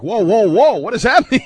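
high, loud cries of disbelief; retold alarmed objection. Co-host replaying the hosts' reaction to a gaffe, loud alarm. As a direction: cut in with mock alarm, laughter creeping in.

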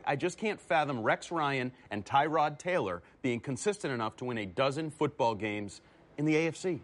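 usual speaking voice

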